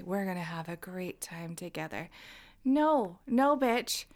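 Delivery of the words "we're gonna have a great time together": creepy whisper. Retold or imitated. Mocking a forward guy.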